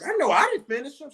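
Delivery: high, fast, startled "I know I didn't finish 'em"; mock alarmed double take; retold disbelief. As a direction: replay the jolt of finding the job done. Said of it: "I'm like".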